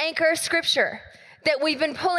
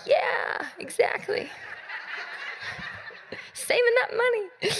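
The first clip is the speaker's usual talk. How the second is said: high, mock-smug moms; homeschool moms; 8 semitones higher, 4 dB quieter than usual. Pastor mimicking homeschool moms in a high voice. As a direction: squeak it like a smug mom. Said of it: "All you homeschool moms are like".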